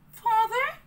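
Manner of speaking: put-on high, childlike puppet voice; puppet boy voice. A narrator's reading. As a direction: play a nervous little puppet. Pinocchio voiced in story.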